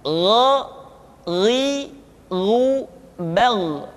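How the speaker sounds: "Gha, ri, ru, bal" with a heavy, thick gh from the back of the throat; gha ri ru bal, thickened throat sound; thick gha demo. Shown as a demonstration. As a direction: say "gha" thick and full, voiced, no breath flowing. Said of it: "suara kita mesti terdengar menebal".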